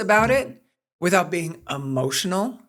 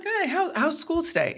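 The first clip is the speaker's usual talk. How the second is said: much higher, bright sing-song hello; cheery parent greeting; 10 semitones higher than usual. Retold or imitated. Mom greeting her daughter after school, high and chirpy. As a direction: chirp it like an upbeat mom at pickup. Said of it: "And I was like".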